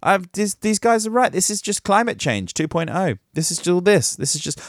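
usual speaking voice

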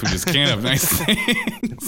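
laughing through the words; slow, laughing lament. Real, not acted.

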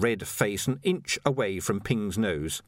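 ordinary delivery, nothing marked

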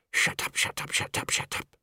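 rapid repeated whispered hushing; urgent whisper. Acted. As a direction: hiss it under your breath to silence him. Panicked hushing before the conductor.

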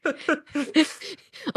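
high, loud, real laughing reply. Real, not acted. Admitting to the host why the show went unwatched, laughing. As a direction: answer through helpless laughter. Laughing over lapsed Netflix subscription.

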